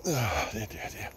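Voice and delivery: breathy whisper of dismay; whispered sigh. Real, not acted. Sighing to himself.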